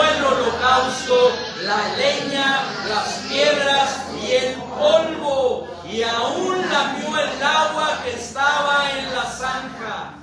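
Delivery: slow, drawn-out scripture declamation; forceful proclamation. Acted. Preacher reads how fire consumed the offering, slow forceful proclamation.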